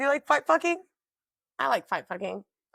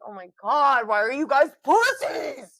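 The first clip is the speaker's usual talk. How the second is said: exasperated; 5 dB louder than usual. Retold or imitated. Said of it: "I'm like".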